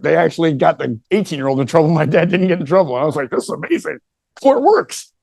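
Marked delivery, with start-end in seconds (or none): 3.3-5.1 high-pitched mock wonder, rising sharply; retold amazement. Kid learning dad avoided trouble in court, acted amazement. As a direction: climb high in naive delight. Child marvelling at the court outcome.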